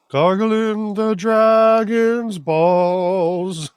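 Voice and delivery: slow drawl. Pretend. Drawn-out mocking repeat.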